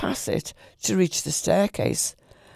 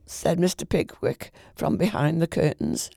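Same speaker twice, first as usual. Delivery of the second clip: ordinary delivery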